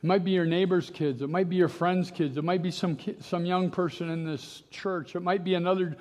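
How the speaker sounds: normal talking voice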